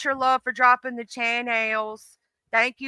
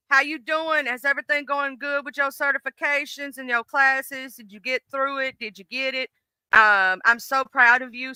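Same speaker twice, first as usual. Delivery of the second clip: ordinary talk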